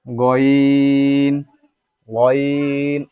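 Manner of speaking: গয়িন with the oi held long; drawn-out, steady গয়িন; elongated glide. Shown as a demonstration. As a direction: stretch each গয়িন slowly on a level note. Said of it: "এক আলিফ পরিমাণ করব".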